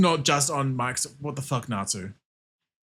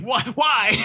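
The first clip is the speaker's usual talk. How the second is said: loud, high, disbelieving "Why?"; exasperated shout; 11 semitones higher, 5 dB louder than usual. Real, not acted. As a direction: yell it at the screen in disbelief. Exasperated at the game.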